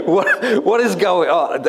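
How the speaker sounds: high, incredulous with a laugh; retold disbelief. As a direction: replay the shock of the police lights with a laugh. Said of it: "I'm going".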